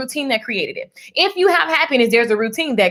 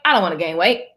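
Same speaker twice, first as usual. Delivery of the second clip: dropped lower, dismissive about-face; mock refusal; 7 semitones lower, faster than usual. Acting out flip-flop energy, mock low refusal. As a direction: drop down for the contrary side.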